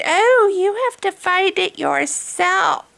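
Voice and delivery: high, slow, drawn-out mock voice; mocking imitation. Vlogger mimics commenters who prattle without thinking, mocking. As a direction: parody a thoughtless commenter in stretched syllables. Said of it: "you don't even think about what you're saying".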